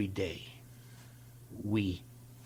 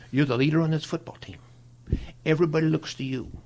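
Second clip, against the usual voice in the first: firm, louder and higher pep talk; retold pep talk; 5 semitones higher, 5 dB louder than usual. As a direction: press it home to the young player.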